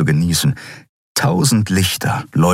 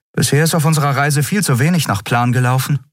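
higher, quick and dry; wry young captain; 9 semitones higher, faster than usual. A narrator's reading. Captain wryly comments on their luck, high and quick. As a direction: let the irony run fast.

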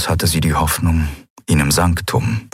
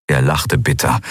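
ordinary delivery

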